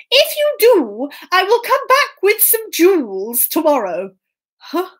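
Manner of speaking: high, lilting promise; persuasive Puss. Acted. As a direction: tempt the workers with a reward. Puss bribes the workers.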